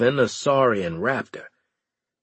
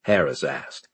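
ordinary delivery, nothing marked

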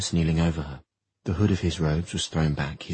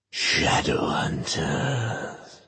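slow, drawn-out otherworldly; an eerie voice from everywhere; eerie demon voice; much slower than usual. Acted.

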